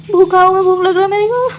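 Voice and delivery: mock pitiful hunger whine; whiny hungry moan.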